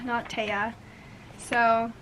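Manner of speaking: ordinary delivery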